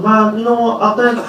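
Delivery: Barkley going off on a tangent at halftime; Charles Barkley impression. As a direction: ramble like a TV pundit off topic. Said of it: "he'll be at the halftime show like".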